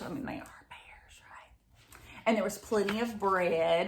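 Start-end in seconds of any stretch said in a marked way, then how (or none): 0.0-1.8 soft conspiratorial whisper; whispered aside. Real, not acted. Teacher whispers a joke about bears to the kids. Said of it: "aside whispered to kids".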